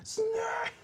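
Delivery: high shout of Snake; shouted name. Pretend. Calling out the character.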